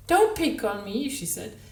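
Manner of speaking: high, loud, whiny self-pity; whining child's tone. A narrator's reading. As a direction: mimic a sulky child to needle him. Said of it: "the whining, self-pitying tone".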